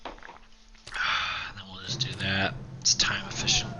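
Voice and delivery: real slow whisper. Real, not acted. Gamer whispering his plan with the baby nearby, slow whisper. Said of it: "My baby's in the room".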